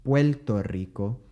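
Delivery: Puerto Rico said Puelto Rico with a Puerto Rican l; Puerto Rican accent. Retold or imitated. Teacher imitating a Puerto Rican saying Puerto Rico. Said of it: "as some Puerto Ricans would say".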